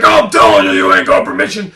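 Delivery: gruff angry man. Retold or imitated. Landowner threatening Christina, low and gruff. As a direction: growl the threat. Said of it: "Dude's like".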